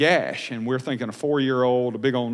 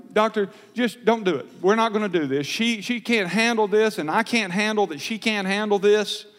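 higher, urgent pleading; anxious protest; 7 semitones higher than usual. Retold or imitated. Father begging the doctor to spare his daughter, anxious protest. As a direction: plead to call the whole thing off.

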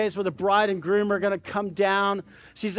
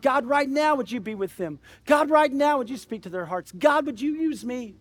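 fervent intercession; 5 semitones higher than usual. Acted. Preacher praying for lost family and friends, fervent intercession. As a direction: cry out each plea, then let it fall.

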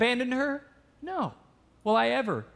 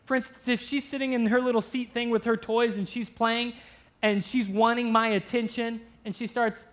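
normal talking voice

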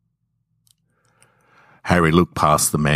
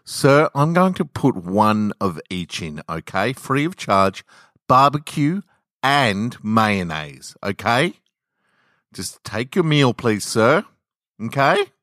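firm, sugary and dismissive, higher; overpolite dismissive; 6 semitones higher than usual. A narrator's reading. Cashier dismisses the angry customer, firm and overpolite. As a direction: brush him off with fake politeness. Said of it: "firm, overpolite, dismissive tone".

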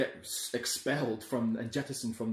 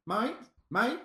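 high squawked "Mate, mate, mate"; seagull squawking mate; 11 semitones higher, slower than usual. Retold or imitated. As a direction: squawk "mate" like Aussie gulls. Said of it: "'cause they're Australian".

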